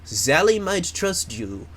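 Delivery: hammed-up fake French accent; terrible French accent. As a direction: do a bad French accent, warning someone off. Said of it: "this is a terrible French accent".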